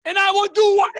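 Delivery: imitating an eager immigrant, shouted high and loud; hammed-up yell as a newcomer craving the bagel; excited yelling. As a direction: yell it like you're grabbing the prize. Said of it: "Now, why am I yelling?".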